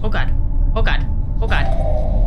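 loud, panicky repeated outburst; real alarm. Real, not acted. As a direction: blurt it out loud in fright. Startled in a spooky game scene.

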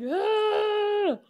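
a high, sharp, mock-panicked yelp. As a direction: yelp like the cars just touched. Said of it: "and I'm just like".

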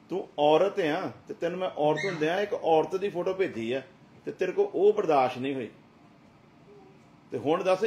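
loud, high preaching at full force; fiery preaching. Acted. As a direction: thunder it at the congregation. Pastor rebuking at full force.

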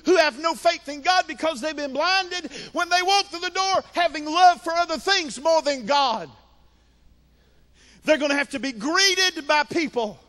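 high, driving pulpit cadence; impassioned preaching. Acted. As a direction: build the phrases as a fervent preacher. Preaches at full force.

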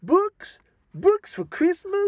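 put-on high, loud spoiled-child whine; mock a greedy child let down; whiny kid. Imitating little Johnny whining at his Christmas books. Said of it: "he's gonna come around and say".

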